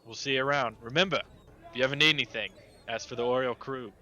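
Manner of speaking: hearty sailor. Acted. Sailor Clyde calling to the party while unloading, loud and hearty. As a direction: call out a friendly goodbye over cargo. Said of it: "and he goes".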